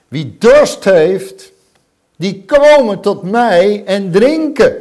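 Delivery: loud, slow proclamation; Jesus calling loudly to the crowd; ringing slow call in the temple. Acted. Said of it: "En hij roept".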